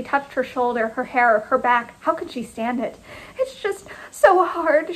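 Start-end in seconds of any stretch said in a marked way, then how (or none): 3.4-4.9 high, slow, tearful gasp; distressed, higher and drawn out. A narrator's reading. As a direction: gasp it out, breaking down. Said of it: "she gasped".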